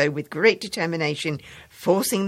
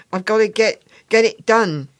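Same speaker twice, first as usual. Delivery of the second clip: put-on a boy's higher, louder insistence; busy kid brushing the teacher off; hurried schoolboy; 6 semitones higher, 8 dB louder than usual. Boy insists he can't stop.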